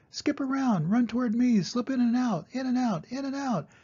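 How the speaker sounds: very high, quick goose voice; a squawking goose coaching; the goose directing Wilbur's escape in a very high voice; goose giving orders. A narrator's reading. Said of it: "began to give orders".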